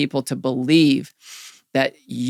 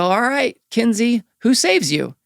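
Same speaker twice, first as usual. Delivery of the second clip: put-on higher, louder coaching call to a toddler; upbeat swim instructor; 9 semitones higher, 4 dB louder than usual. Swim instructor calls to a three-year-old at the pool, high and bright. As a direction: cheer the little one on from the poolside. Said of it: "the swim instructor would … go".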